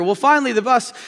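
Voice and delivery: usual speaking voice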